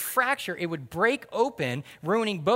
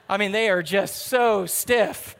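laughing; 2 semitones higher, 5 dB louder, slower than usual. Real, not acted. Laughing at stiff new jeans.

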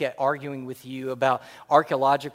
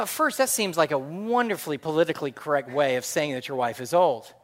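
normal talking voice

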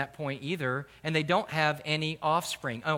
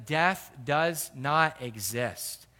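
slow emphasis; 2 semitones lower, much slower than usual. Acted. Preacher slows on key line.

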